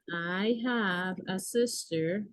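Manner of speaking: slow, word by word, like reading to a class; slow model reading. Shown as a demonstration. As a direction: spell each word out slowly for students. (lectura lenta y pausada de ejemplo)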